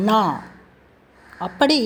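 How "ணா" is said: tongue curled to the palate on நா; retroflex நா. Shown as a demonstration. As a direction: say நா as a strong retroflex "na". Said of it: "தமிழில் மூன்று சுழி".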